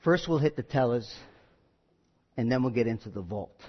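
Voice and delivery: low, soft conspiratorial mob talk; hushed gangster plotting; Italian gangster. Retold or imitated. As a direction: scheme it like a big Italian gangster. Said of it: "one guy turns to the other guy and says".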